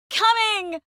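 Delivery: high, loud call back. Acted. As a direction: holler it to someone out of sight. Calling back to someone.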